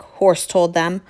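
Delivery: plain reading voice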